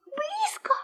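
high, hushed worried mouse. Acted. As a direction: ask it anxiously, squeaky mouse. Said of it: "заволновался мышонок".

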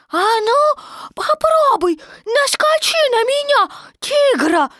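put-on very high, eager child-like dare; heated, high-pitched tiny challenger; fervent little Roo. A narrator's reading. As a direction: dare him excitedly in a squeaky voice. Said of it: "с жаром заявил крошка Ру".

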